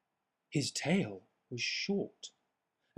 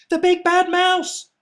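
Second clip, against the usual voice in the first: high, loud shriek of fright; terrified yell; 14 dB louder than usual. Acted. Gruffalo's child terrified by the huge shadow, shrieking. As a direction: scream it and bolt. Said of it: "yelled the Gruffalo's child".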